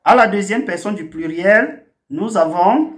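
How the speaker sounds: usual speaking voice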